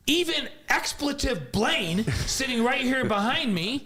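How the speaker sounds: high, drawn-out mock yell; retold coach's tirade. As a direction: imitate a coach hollering at his team. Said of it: "at the top of his lungs".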